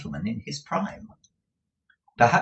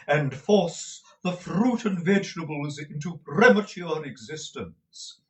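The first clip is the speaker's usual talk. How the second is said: put-on florid, theatrical old suitor, high and slow; old gentleman; 3 semitones higher, slower than usual. A narrator's reading. Dotty old gentleman courting Mrs Nickleby over the wall, grand and slow. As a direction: woo her grandly like a dotty old gallant.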